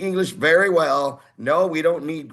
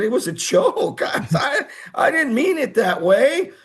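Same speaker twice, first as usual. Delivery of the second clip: higher, laughing through it; nervous laughing excuse to the company president; laughing apology; 11 semitones higher, 4 dB louder than usual. Retold or imitated. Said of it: "embarrassed explaining".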